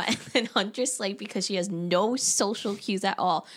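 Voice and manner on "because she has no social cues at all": ordinary talk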